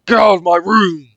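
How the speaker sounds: enraged yell at the kidnapper; furious shout. Acted. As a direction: scream him out of the room. Quarrel, she shouts at him.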